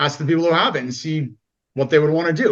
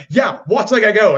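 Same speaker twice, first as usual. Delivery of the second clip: louder, higher show-off call; mock jock bravado; 5 semitones higher, 6 dB louder than usual. Jock showing off to friends, louder. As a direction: play a cocky jock. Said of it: "When it was like".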